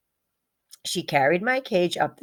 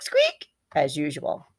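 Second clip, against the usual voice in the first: plain reading voice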